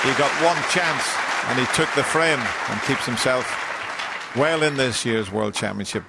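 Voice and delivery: loud, high, excited commentary. Real, not acted. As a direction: lift it up as the frame is won. Frame won, commentator lifts voice.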